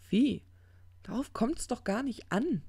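high, irritated dismissal; exasperated retort. Acted. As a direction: brush the objection aside, voice jumping up.

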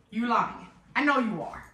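normal talking voice

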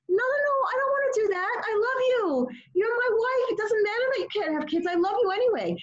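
high, pleading, tender husband voice; a hint of a doting husband reassuring his wife; tender loving husband. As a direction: voice the husband she wished for, gently. Voicing the longed-for loving husband.

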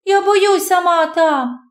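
put-on high, gasping, scared young girl; breathless fear. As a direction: barely get the words out, out of breath. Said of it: "ледве вимовляє, задихавшись".

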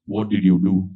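father asking. Retold or imitated. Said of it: "he asks me the question".

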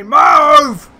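very loud, high-pitched yell; real exasperated outburst at full volume; frustrated shout. Real, not acted. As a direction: bellow it at a driver blocking you. Yelling at a car in the way.

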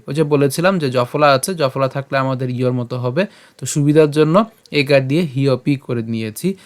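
ordinary delivery

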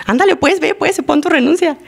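urging someone on in a high voice; goading reply. Retold or imitated. Someone tells her to go ahead and resign, goading.

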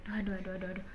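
normal talking voice

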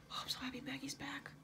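very soft, whispered relief; happy whisper. Real, not acted. Viewer glad that Maggie is back, happy whisper. Moved by a character's return.

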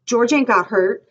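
high, loud denial; crying out. A narrator's reading. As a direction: cry it out insistently. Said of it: "he cried".

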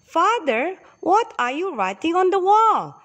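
put-on surprised little boy, pitched high. A narrator's reading. Little boy asks his father about the writing, surprised. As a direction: ask it high in wonder, rising on "Father". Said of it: "he asked in surprise".

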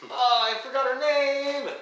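high, loud wail of dismay; exasperated wail. Real, not acted. Game master blanks on a character's name, exasperated wail.

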